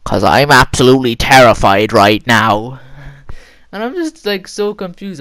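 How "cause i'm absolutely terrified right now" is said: loud, panicked quote of a scared man; terrified stranger. Retold or imitated. Masked man tells the kid beside him he's terrified, loud and panicked. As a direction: blurt it out loud like a grown man terrified on a ride. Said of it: "he just goes to me".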